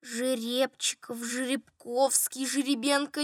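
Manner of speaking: soft, slow musing; recalling. A narrator's reading. Steward searching his memory for a surname, musing quietly. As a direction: try names out under your breath. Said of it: "стал припоминать фамилию".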